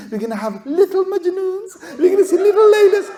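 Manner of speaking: hammed-up family delight; mock excited. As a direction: gush like excited relatives. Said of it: "families have met".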